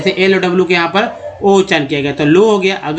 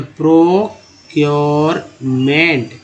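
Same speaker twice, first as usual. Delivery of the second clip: Procurement very slowly, pro-cure-ment; syllable by syllable; much slower than usual. Shown as a demonstration. Teacher fitting CURE into the word, procurement slow and divided. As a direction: stretch each part of procurement. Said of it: "सीयूआर को cure बोलते हैं".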